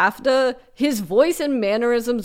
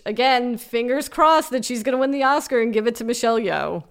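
normal talking voice

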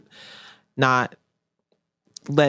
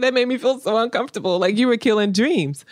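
high, quick imitation of an uncomfortable partner; mock-protest in a raised, hurried voice; upset partner, retold; 8 semitones higher than usual. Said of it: "And he was like".

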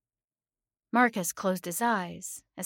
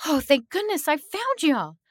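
high, loud burst of relief; relieved cry; 10 semitones higher, 9 dB louder than usual. A narrator's reading. Narrator to chained friends at last found, relieved cry.

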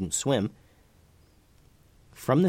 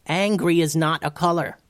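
high, mildly yelled correction; raised-pitch retort from down a hole; exasperated yell; 7 semitones higher than usual. A narrator's reading. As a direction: yell it back in a higher pitch. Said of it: "yelled Tubes".

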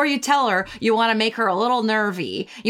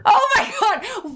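laughing shout; 12 semitones higher, slower than usual. Real, not acted. Shocked laughing outburst.

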